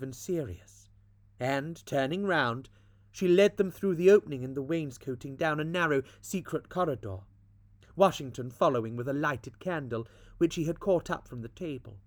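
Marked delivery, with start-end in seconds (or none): none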